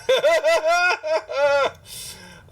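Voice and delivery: loud, high burst of laughter; real laugh. Real, not acted. Game master cracking up at a player, real loud laugh. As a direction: break up laughing.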